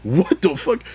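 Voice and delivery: very high "what?"; high incredulous yelp; shocked. Retold or imitated. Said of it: "And I was like".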